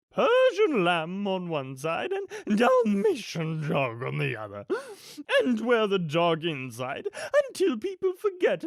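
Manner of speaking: high, swooping grande-dame villain voice; loud, theatrical and callous schemer; imperious villainess. Acted. Acts the villainess Cruella.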